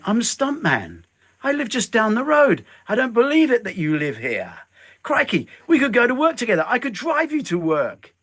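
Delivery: high, quick and delighted; eager excitement. Retold or imitated. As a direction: gush like a thrilled fan who just met his hero. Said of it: "And I went".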